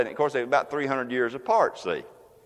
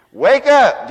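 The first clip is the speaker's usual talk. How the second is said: very loud, high cry; loud command; 9 semitones higher, 11 dB louder than usual. Acted. Jesus commanding the dead church to wake. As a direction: shout it like a trumpet call. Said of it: "Jesus said".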